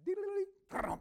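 beatbox the drummer's fill; drum fill imitation. Preacher mimics the gospel drummer joining in, loud and high. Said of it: "the drummer played too".

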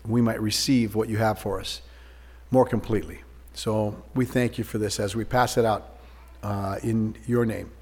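prayer. Acted. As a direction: pray quietly over the bread.

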